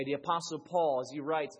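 usual speaking voice

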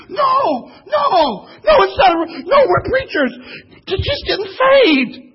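high, loud, laughing protest; mock panicked no, no, no; frantic denial. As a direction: shout them down in a panic, half laughing. Preacher frantically denies robbery.

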